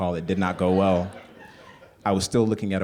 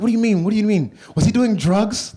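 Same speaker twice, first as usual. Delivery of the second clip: much higher and louder, mother jumping to worry; alarmed Ugandan mom; 14 semitones higher, 10 dB louder than usual. Retold or imitated. Mother fearing her son met drug users, high alarmed. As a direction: fret loudly, straight to the worst case. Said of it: "the Ugandan mom".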